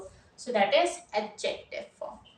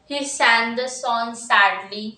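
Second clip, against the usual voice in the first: slow dictation; much slower than usual. Shown as a demonstration. Teacher dictates example sentence.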